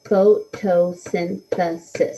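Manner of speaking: "photosynthesis" slowly broken into five separate beats; syllable by syllable. Shown as a demonstration. Teacher counts out syllables for the class, "photosynthesis" in separated beats. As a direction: clap out "photosynthesis" one syllable at a time. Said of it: "how many syllables it has".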